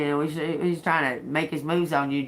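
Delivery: ordinary talk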